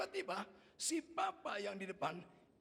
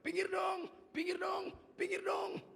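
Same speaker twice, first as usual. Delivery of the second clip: high, loud repeated plea; high singsong calling; child talking to ants; 12 semitones higher, 4 dB louder, slower than usual. Retold or imitated. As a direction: shoo the ants like a little child. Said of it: "mulai ngomong sama semut".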